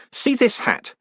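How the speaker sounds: hint of a sore, testy postmaster; lightly voiced, high-pitched challenge; brusque postmaster. A narrator's reading. Postmaster shows his hat to the clerk, testily.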